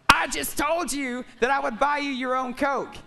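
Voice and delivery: loud, high exasperated retort. Retold or imitated. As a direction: snap back, fed up. Annoyed reply to partner.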